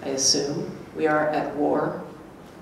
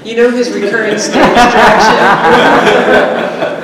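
loud, higher, laughing as she asks; laughing through it; 5 semitones higher, 12 dB louder than usual. Acted. Teasing her friend about Beckett, laughing. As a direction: ask it while breaking into laughter. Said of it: "Brief laugh".

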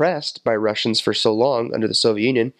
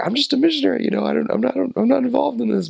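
much higher, timid little voice; meek protest; 12 semitones higher than usual. Retold or imitated. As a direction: squeak out that you're only a missionary. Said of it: "I was just like".

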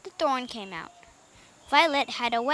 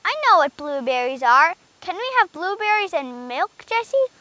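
put-on eager child's plea, raised in pitch; louder, higher, keen young Benny; excited cry; 4 semitones higher, 6 dB louder than usual. A narrator's reading. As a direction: ask eagerly, lifting the voice. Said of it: "cried Benny".

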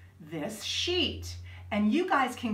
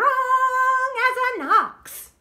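higher, louder, slow and sung; mock strong; 12 semitones higher, 8 dB louder, much slower than usual. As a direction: belt it out like a strongman. Reading strong line.

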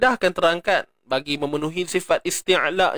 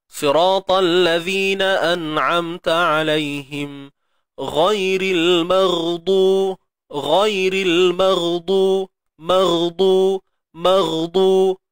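slow, drawn-out chanted recitation; Quran recitation; 2 semitones higher, much slower than usual. Shown as a demonstration. Teacher reciting al-Fatihah for students. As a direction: recite slowly, lengthening each word. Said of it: "recitation demo".

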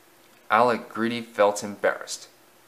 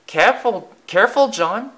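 put-on higher, louder anxious boy's warning; worried boy; 10 semitones higher, 5 dB louder than usual. Church boy warns John in the tree, higher and anxious. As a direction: warn your friend up the tree, higher. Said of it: "said one".

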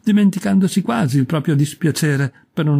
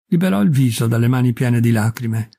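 usual speaking voice

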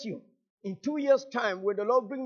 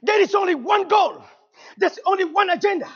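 high, loud, impassioned challenge; full-force preaching; 8 semitones higher, 10 dB louder than usual. Real, not acted. Preacher challenging the congregation about tired churches, full-force preaching. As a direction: drive each statement home at a raised, preaching pitch.

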